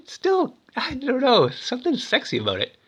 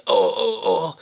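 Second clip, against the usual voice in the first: frightened whimper; 3 semitones higher, slower than usual. Retold or imitated. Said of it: "they all freak out".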